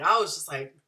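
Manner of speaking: ordinary talk, nothing marked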